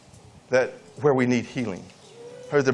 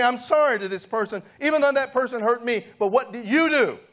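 raised, higher preaching voice pressing the congregation; fervent preaching; 10 semitones higher than usual. Acted. Pastor calls the audience to humble themselves, fervent preaching. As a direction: pastor urges listeners to apologize, fervent preaching.

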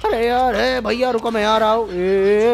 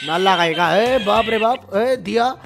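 usual speaking voice

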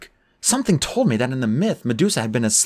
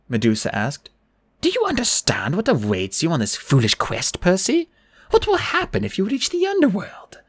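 put-on coaxing old woman, swooping high; Medusa; 8 semitones higher than usual. A narrator's reading. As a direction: tempt sweetly, sinking into a hush at the end.

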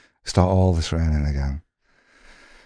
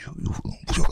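whispered jabbing; 8 dB quieter than usual. Retold or imitated. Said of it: "He's going".

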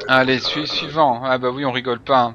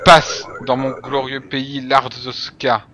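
louder, slow patriotic boast; proud loud guard; 7 dB louder than usual. Acted. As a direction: proclaim it like a proud official. Guard boasts of his country.

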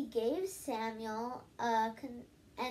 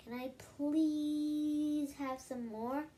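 put-on slow, higher begging boy's voice; pleading little boy; 4 semitones higher, slower than usual. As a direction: beg sweetly for more, slowly. Child begging mom for food.

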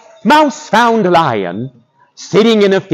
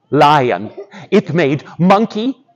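ordinary delivery, nothing marked

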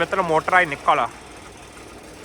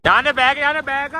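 shouting; 7 semitones higher, 6 dB louder than usual. Real, not acted. Shouting during pipe pulling.

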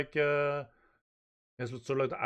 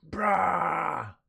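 high drawn-out yell; background cry of dismay; 9 semitones higher, 4 dB louder, much slower than usual. Acted. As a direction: wail in comic despair. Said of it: "in the background go".

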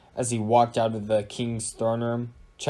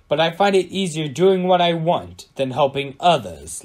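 plain reading voice

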